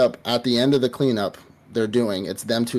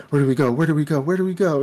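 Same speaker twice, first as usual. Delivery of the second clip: frantic repeats climbing in pitch; mock panic; 5 semitones higher, faster than usual. He has to flee the hospital, mock panic climbing higher. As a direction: imitate rising panic, higher each time.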